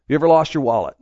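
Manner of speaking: normal talking voice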